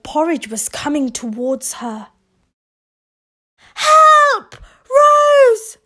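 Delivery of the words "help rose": much higher, louder frantic yell; desperate cry for help. Acted. Mother screaming to Rose for help. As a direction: scream for your daughter. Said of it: "cried her mother".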